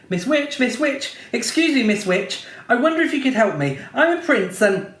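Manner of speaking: high, quick and pleading; eager pleading prince. A narrator's reading. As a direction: call out eagerly for help. Prince calls again.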